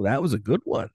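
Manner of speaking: usual speaking voice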